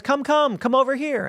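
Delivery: high-pitched, smiling coaxing; smiling invitation. Acted. Smiling stranger luring the traveler over, coaxing. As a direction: play a friendly stranger beckoning someone over. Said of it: "he smiles and he says".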